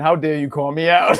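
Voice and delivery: laughing through a mock-offended goldfish; a laughing, lightly voiced indignant fish. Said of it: "a goldfish saying".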